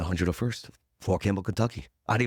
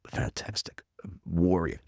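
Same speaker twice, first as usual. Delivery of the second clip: whispered praise; 2 semitones lower, 3 dB quieter, slower than usual. Real, not acted. Awed praise of the boss.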